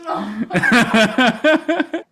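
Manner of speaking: big real laugh; loud laugh. Real, not acted. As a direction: burst of loud high laughter. Laughs at Chris's thumbs up.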